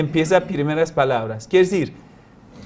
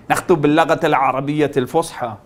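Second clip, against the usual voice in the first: rattled-off Arabic; much faster than usual. Retold or imitated.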